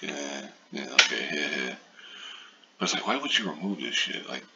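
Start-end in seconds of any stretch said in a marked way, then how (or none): 0.0-1.7 lukewarm reaction. Retold or imitated. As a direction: imitate a shrugging, unimpressed listener. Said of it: "you're kinda like".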